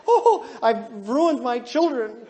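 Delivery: slow, hammed-up wail of guilt; mock anguish, drawn out; guilt-stricken. Blue parent going home after class, hammed-up guilt. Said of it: "go home and feel guilty".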